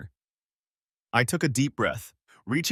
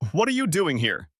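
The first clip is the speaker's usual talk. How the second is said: higher and louder, a furious jab; spat out; 7 semitones higher, 4 dB louder than usual. Acted. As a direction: spit the words at her. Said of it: "he spat".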